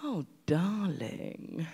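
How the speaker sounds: slow, drawn-out and hushed, a lightly voiced diva; unhurried, purring "Oh, darling" like a grand star. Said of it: "And she said".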